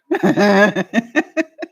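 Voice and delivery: short rising laugh at her own joke; amused rising chuckle; real laugh. Real, not acted. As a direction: let a real laugh bubble up.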